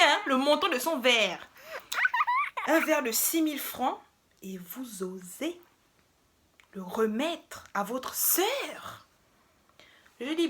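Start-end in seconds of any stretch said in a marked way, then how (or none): 4.4-9.1 slow, hissed whisper; indignant whisper. Retold or imitated. Imitating the man scolding her, slow scandalised whisper. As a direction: hiss it slowly, shocked and scandalised.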